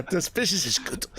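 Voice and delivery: Dutch-sounding "business is good"; Dutch-leaning accent again; mock Dutch accent. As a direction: keep the accidental Dutch. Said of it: "he's Dutch now".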